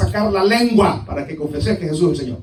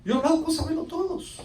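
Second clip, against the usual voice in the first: mocking; 9 semitones higher, 4 dB quieter than usual. Real, not acted. Mocking know-it-alls.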